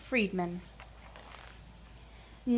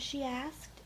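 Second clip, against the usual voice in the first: ordinary delivery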